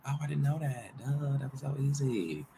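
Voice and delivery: a light dopey voice for him; mock student. Mocking the student who found it easy, lightly voiced. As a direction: toss it off as a lightly voiced know-it-all. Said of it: "He's like".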